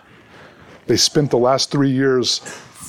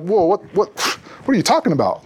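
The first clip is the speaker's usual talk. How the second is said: shocked disbelief; 5 semitones higher than usual. Retold or imitated. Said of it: "and they're like".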